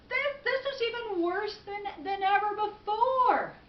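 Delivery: acted indignant gripe; exasperated complaint. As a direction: complain loudly, stretching the last words. Man complains to old woman.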